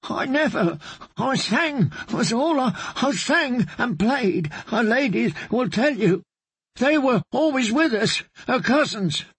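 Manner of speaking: high, frightened pleading bard; terrified bard pleading. Acted. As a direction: plead for his life, voice up high.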